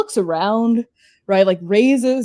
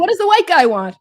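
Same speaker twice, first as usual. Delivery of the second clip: higher, lightly voiced puzzled regular; a customer asking his friend what's going on; bemused regular; 8 semitones higher, 3 dB louder than usual. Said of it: "you hear Wang Hai go".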